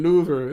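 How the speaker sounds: "louver" with an oo in place of short i; mispronounced liver. Shown as a demonstration. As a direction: say "louver", LOO-ver, for liver. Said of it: "has a lot of oohs in it".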